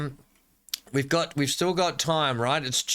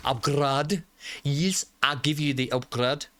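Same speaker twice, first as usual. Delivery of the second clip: put-on best-shot South African accent; 2 semitones higher than usual. Gamer granting an upgrade, in an attempted South African accent. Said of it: "I'm gonna give my best South African shot here".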